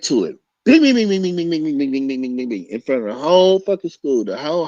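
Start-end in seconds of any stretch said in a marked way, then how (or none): 0.6-2.7 rapid bing punches, high then fading; punch sound effects. Retold or imitated. Teller acting out hitting the rival, punches fading. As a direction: mimic a flurry of punches.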